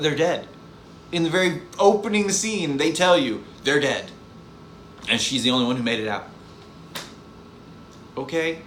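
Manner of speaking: ordinary talk, nothing marked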